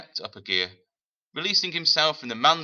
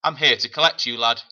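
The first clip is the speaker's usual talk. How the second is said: a lightly voiced tough woman, louder and firm; a touch of a hard northern matron; gruff woman; 3 semitones higher, 8 dB louder than usual. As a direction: announce it like a stern minder. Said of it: "a deep, gravelly voice".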